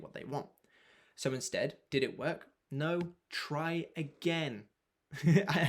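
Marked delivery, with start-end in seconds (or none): 2.7-4.6 drawn out, very slow, each word weighed; emphatic and slow. Shown as a demonstration. Coach stressing to keep trying, very slow. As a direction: lean on it slowly so it sinks in. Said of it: "I can't stress that enough".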